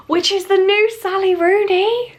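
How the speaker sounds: delighted squeal at the book; thrilled. Real, not acted. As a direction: gush over the gift. Thrilled discovery of gift book.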